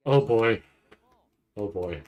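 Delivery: ordinary talk, nothing marked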